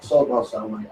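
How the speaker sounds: an inmate low-key asking the officer to sort his cell; cheeky prison request. Retold or imitated. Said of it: "I used to say that".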